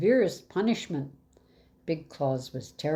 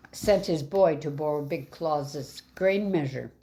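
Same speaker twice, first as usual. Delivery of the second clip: ordinary delivery, nothing marked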